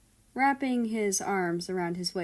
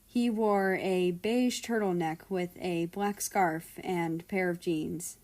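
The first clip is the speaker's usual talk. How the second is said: usual speaking voice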